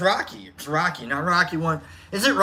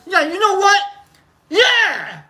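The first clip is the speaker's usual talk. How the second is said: excited; 11 semitones higher, 3 dB louder than usual. Pretend. Mock enthusiastic agreement.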